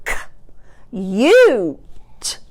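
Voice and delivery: "K-, u-t" in separate sounds, middle drawn out; chopped-out word. Shown as a demonstration. Teacher changing the middle sound for pupils, chopped apart. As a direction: sound out CUTE piece by piece. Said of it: "change the middle sound".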